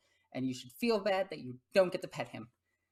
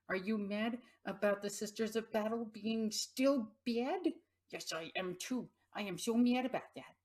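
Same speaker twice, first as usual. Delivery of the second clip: pet talk; 4 semitones higher than usual. Real, not acted. Telling her cat she is mad too, light pet voice. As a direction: commiserate with the cat playfully. Talking to cat about army.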